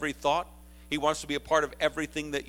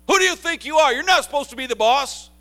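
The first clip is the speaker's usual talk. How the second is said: loud, high, accusing shout; indignant challenge; 11 semitones higher, 13 dB louder than usual. Retold or imitated. Aaron and Miriam confronting Moses in a loud, high, indignant shout. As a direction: hurl it at him like an outraged sibling. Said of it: "when Aaron and Miriam came to Moses".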